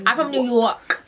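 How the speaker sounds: playful put-on New York accent; hammed-up New York attitude; mock New York accent. Vlogger claiming to be from New York, mock accent. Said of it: "I like the New York".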